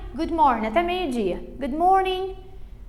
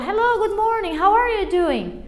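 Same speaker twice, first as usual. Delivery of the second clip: high-pitched acted greeting; greeting someone; 5 semitones higher than usual. Person approaches the student with a question, high-pitched greeting. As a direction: play someone arriving with a drawn-out greeting. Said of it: "vai chegar pra você e vai falar".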